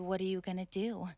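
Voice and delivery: normal talking voice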